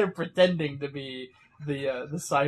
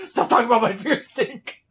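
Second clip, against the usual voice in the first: louder, higher irritated retort; mock angry snap; 6 semitones higher, 8 dB louder than usual. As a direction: whip around and snap. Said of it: "Raph whips around and goes".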